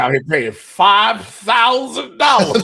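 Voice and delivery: loud, high, drawn-out disbelief; comic outrage at full volume; outraged shout. Real, not acted. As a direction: shout it slowly, appalled at the price. Outrage at ticket prices.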